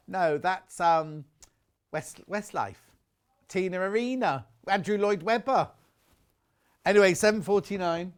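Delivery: usual speaking voice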